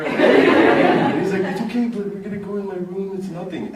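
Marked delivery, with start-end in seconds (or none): 1.4-3.7 breezy roommate. Retold or imitated. Roommate shrugging off complaint.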